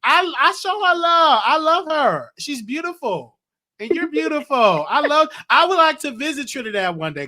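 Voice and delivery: high, loud and gushing; excited gushing. Real, not acted. Host gushing to caller from Trinidad, excited and loud.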